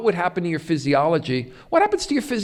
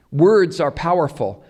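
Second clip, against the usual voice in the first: ordinary talk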